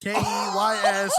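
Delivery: slow, shouted spelling of each letter; spelled-out shout. Retold or imitated. Friend teasing about Corey's keys, a slow shouted spelling. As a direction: yell out the letters one by one. Mocking Corey's drunk demand.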